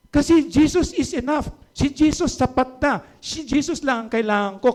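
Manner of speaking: high, fervent declaration, easing down; impassioned preaching. Acted. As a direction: proclaim it with conviction. (maalab na pagpapahayag)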